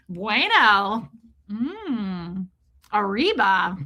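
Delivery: lightly hammed-up Spanish exclamations; token Spanish show-off. Passenger playing along with Spanish-speaking seatmates, lightly hammed-up Spanish. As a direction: stretch out each Spanish word with a swoop.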